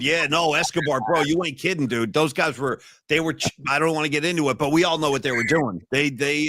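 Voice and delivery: ordinary talk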